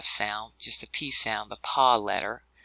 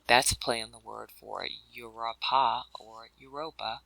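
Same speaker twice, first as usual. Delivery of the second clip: ordinary talk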